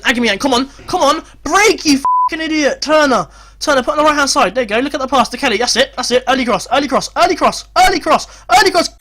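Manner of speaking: loud, high, building urgency; urgent shouting. Real, not acted.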